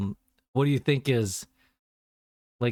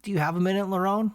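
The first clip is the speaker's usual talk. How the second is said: salesman caller; 9 semitones higher than usual. Retold or imitated. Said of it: "he's like".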